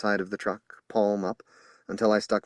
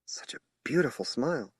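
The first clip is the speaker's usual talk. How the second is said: whispered; 5 semitones higher, 4 dB quieter than usual. A narrator's reading. Narrator's awed aside.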